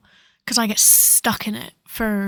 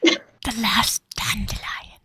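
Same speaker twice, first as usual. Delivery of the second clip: whisper; much slower than usual. Pretend. Quoting a meme in hushed voice.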